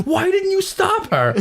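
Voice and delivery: very high, drawn-out shouted question; retold outburst at a child; exasperated dad yell. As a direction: demand an answer in shrieking exasperation. Retells yelling at son.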